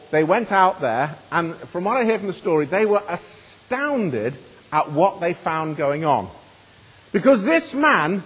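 ordinary talk

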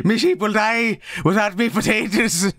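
high-pitched comic Irish plea; mock Irish accent. Streamer fretting over sheep as a joke, hammed-up Irish accent. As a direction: overdo a high Irish farmer's brogue. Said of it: "what part of Ireland is that specifically".

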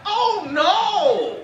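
imitating wife's high alarmed oh no. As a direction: cry it out like disaster struck. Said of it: "she likes to say it like this".

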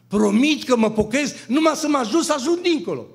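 high, loud, half-sung plea; fervent pleading. Retold or imitated. Dreamer pleads loud and high with the heavenly voice. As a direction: beg with all your heart to be let across.

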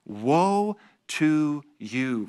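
drawn-out grave woe, very slow; slow solemn woe. Acted. Preacher intoning woe to the crowd, slow and solemn. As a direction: let the woe hang heavy and slow. Preacher reading Jesus's rebuke.

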